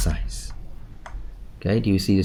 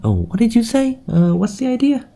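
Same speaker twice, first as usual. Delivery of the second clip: high, confused examiner asking again; puzzled examiner; 14 semitones higher than usual. Acted. Teacher mimicking a confused examiner. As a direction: act out a lost listener leaning in. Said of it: "if they have to really lean in".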